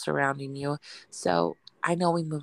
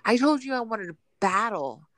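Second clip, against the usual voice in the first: put-on loud, higher kid's whine; quoting her son's demand to battle, a mock kid whine; imitating son; 4 semitones higher, 4 dB louder than usual. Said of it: "He's like".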